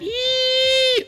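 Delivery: high-pitched squeal of delight; excited squeal. Acted. Celebrating a scoreboard place.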